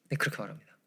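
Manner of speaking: ordinary talk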